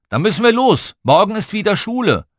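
high, loud parental announcement; calling out. A narrator's reading. Dad below calls up to groaning children, loud call. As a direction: give the kids a loud warning.

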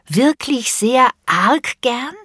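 put-on slow sing-song child voice; drawn-out child question. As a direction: stretch each word to tease. Child pushes again, slowly.

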